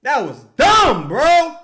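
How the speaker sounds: slow, loud, disgusted; angry shout. Real, not acted. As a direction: spit out each word in anger. Angry verdict on the play.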